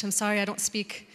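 normal talking voice

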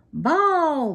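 loud, high umpire's call; booming call. A narrator's reading. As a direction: boom it out across the field. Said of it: "boomed the ump".

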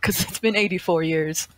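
put-on old person voice. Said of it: "This is my old person voice".

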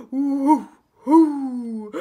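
loud woofing; big acted dog barks; barking dog. Daunted dog woken by the sneeze, loud barking. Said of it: "who barked".

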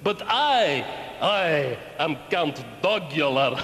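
put-on low, hammy Count's vampire accent; ridiculous vampire accent. Count Dogula answering children's question about blood, ridiculous vampire accent. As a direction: intone it like a pantomime Transylvanian vampire, laughing evilly. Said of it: "talking in that ridiculous accent".

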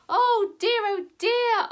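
put-on loud, high old lady lament; dismayed old lady. Old lady faced with goat's mess, dismayed. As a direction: wail it lightly like a flustered old lady.

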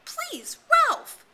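high, loud pleading shout. A narrator's reading. Piggy shouting after the running Ralph, high pleading yell. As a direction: call after him as he runs. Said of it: "Piggy shouted".

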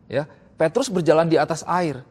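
normal talking voice

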